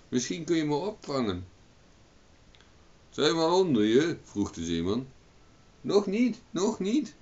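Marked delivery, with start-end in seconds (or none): 0.1-1.4 calling out. A narrator's reading. Owen calls down to sailor. 3.1-4.2 high, loud question; calling up. A narrator's reading. Sailor checks position under Owen, calling up loudly. 5.8-7.1 very high repeated call, rising. A narrator's reading. Owen warns the sailor below, high calling out. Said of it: "riep Owen".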